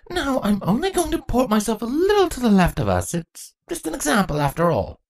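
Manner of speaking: put-on eager, nerdy young girl voice; gushing about history; bookish young changeling. Chrysalis's voice.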